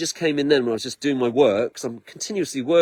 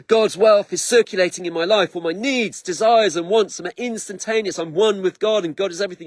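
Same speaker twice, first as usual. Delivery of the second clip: Tony Robbins shout; 6 semitones higher than usual. Retold or imitated. Mimicking Tony Robbins hyping God's wealth affirmations. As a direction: hype it like a motivational speaker. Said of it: "he's going like".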